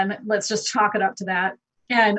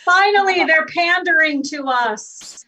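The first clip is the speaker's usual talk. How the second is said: mock triumph; 7 semitones higher, 5 dB louder than usual. Mock-triumphant about history nerds.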